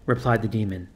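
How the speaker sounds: ordinary delivery, nothing marked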